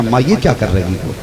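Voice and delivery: quiet, puzzled protest to mother; a low, soft grumble, still patient. Shown as a demonstration. Son quietly questions his mother, soft and puzzled. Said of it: "देखो, लहजा बदल गया दो बार में".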